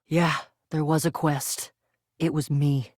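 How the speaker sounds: very low-pitched character voice; voiced story character. Low-voiced character butting in about the quest, deadpan. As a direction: drop the voice right down, deadpan.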